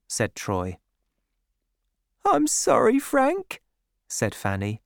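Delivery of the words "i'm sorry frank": put-on high, meek young woman's voice; high-voiced, contrite sweetheart; apologetic girl. A narrator's reading. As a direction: apologise meekly, pitched way up.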